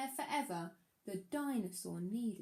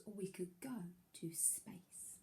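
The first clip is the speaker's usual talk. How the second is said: breathy secret whisper; 3 semitones lower, 11 dB quieter than usual. Acted. Danny whispering his big idea to Dinosaur.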